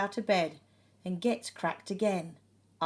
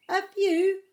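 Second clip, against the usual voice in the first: higher, louder cheerful reply; postman's smiling reply; 11 semitones higher, 7 dB louder than usual. A narrator's reading. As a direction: answer with a smile. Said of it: "The postman smiles".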